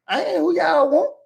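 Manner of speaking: high-pitched mock protest; retold protest. Poor man protesting to the feds, high-pitched mock panic. Acted protest at being locked up.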